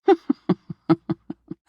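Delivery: high, strange little laugh at the listener; a high laugh, half teasing, half eerie; amused laugh. Acted. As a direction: laugh off their answer, amused. Mentor mocks the dreamer.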